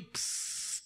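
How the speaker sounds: unvoiced hissed "pss"; quick imitated searing sound; sizzle of a branding iron. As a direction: hiss like hot iron on hide.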